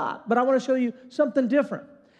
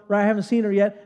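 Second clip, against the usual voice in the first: ordinary talk, nothing marked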